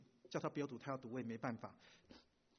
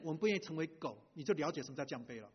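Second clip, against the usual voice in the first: ordinary delivery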